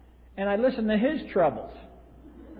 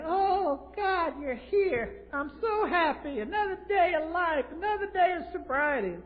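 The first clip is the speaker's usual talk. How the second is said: much higher, slow, near-crying joy; overjoyed; 9 semitones higher, 3 dB quieter than usual. Retold or imitated. Dying Stanley greeting Ken, high and tearfully overjoyed. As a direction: cry out with tearful gratitude for life.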